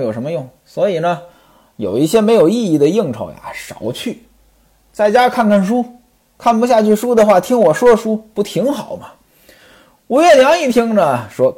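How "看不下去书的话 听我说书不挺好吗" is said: ordinary delivery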